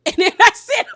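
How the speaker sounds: can barely get it out for laughing; laughing hard. Real, not acted. Host cracking up at Quad's answer, laughing.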